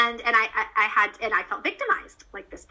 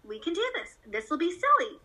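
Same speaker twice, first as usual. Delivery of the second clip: high, cheery we've-got-this pep talk; upbeat reassurance; 9 semitones higher than usual. Acted. Mom showing her hospitalized daughter it's fine, upbeat reassurance. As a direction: lift it high, acting relentlessly positive. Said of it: "I wanted my face to constantly be like".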